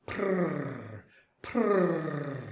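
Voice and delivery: soft low purring sound; cat purring. Acted. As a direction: purr like a cat. Cat purr imitation.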